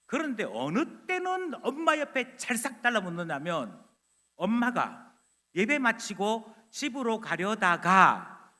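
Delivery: usual speaking voice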